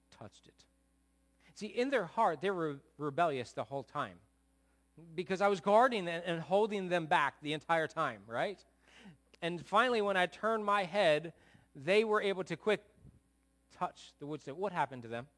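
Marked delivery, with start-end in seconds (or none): 0.1-0.6 dropped to a hush; whispered punchline. Acted. Hushed climax of story. 1.5-14.6 loud preaching build; full-force preacher voice. Acted.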